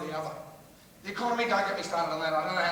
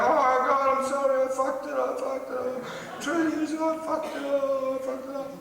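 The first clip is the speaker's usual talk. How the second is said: imitating a sobbing executive, high and loud; hammed-up tearful apology; mock crying; 6 semitones higher, 4 dB louder than usual. Mocking a boss crying on the telly, high wailing apology. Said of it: "he's crying on the telly, going".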